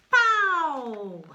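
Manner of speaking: loud high pow; big dramatic pow for a child; spell sound effect. Acted. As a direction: burst out like a magic bang.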